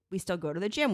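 normal talking voice

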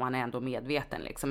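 ordinary talk, nothing marked

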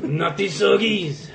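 put-on high, slow French Canadian accent; hammy French Canadian put-on. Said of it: "doing a bad voice for Gambit".

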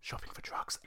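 a quick, hushed whisper under the breath; whispered aside. Real, not acted. Joking aside to listeners about the band's name, whispered. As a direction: drop to a whisper as if nobody should hear. Sly aside after legal disclaimer.

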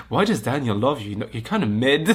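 words breaking into laughs; laughing while talking. Real, not acted. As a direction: roast her through giggles.

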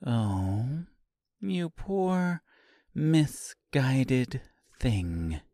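soft, slow mock pity; hushed, precise, drawn-out condolence; perfect diction. A narrator's reading. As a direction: enunciate each word softly, with condescending pity. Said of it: "spoke with perfect diction".

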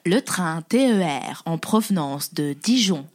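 slow, low station announcement voice; train station announcer. Retold or imitated. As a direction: announce it like the SNCF voice. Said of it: "je fais trop bien la voix de". (voix d'annonce de gare SNCF, lente et grave)

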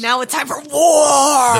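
loud, slow, booming proclamation; dramatic announcement. Pretend. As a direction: boom it out like a trailer. Dramatic announcement of part two.

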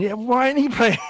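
high, loud mock indignation; indignant disbelief. Retelling their case for Brooks, indignant disbelief. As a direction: act out a baffled fan's protest. Retold indignant questioning about player.

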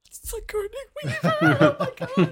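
very high, gushing fan squeal; starstruck squeal. Retold or imitated. Job applicant seeing a movie star, starstruck squeal. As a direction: squeal it in mock awe.

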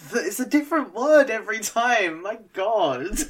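laughing exasperation. Real, not acted. Exasperated at own flub.

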